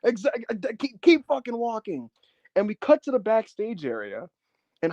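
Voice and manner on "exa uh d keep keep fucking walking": high-pitched jeering send-off; Terry burying him. Retold or imitated. Wrestler burying Onita as he walks off, high mock jeer. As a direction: imitate a scornful heckler, pitched up. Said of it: "Terry's just burying him".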